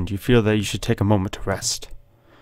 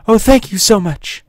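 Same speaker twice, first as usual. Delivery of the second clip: high, gushing British damsel; put-on high British accent; British woman; 10 semitones higher, 4 dB louder than usual. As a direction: gush thanks as a rescued British lady. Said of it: "I don't know why she's British".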